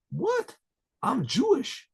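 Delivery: high-pitched acted disbelief, rising at the end; astonished. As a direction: voice leaps up, stunned by the news.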